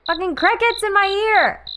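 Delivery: high-pitched irritated yelp; annoyed outburst. Real, not acted. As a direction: squeal in exasperation. Annoyed at cricket sound effect.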